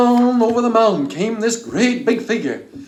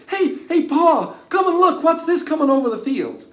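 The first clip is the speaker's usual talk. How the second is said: put-on high, eager child's call to his father; a boy waking his dad to see something; excited boy voice; 10 semitones higher than usual. A narrator's reading. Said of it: "The boy looked out the window and he said".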